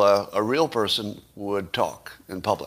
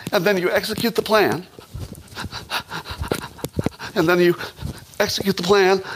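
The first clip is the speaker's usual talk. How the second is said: mocking the Vice President in a pitched-up, overexcited voice; Kamala Harris impression; 8 semitones higher than usual. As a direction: ham up a nervous speech while talking with your hands. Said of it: "And now here's Kamala Harris talking".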